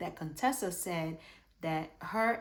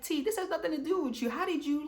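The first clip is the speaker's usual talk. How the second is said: high, fast scolding at T; incredulous scolding; 9 semitones higher, faster than usual. Retold or imitated. Vlogger scolds Sweet Tea for jumping into the fight, high and fast. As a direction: tell her off in disbelief.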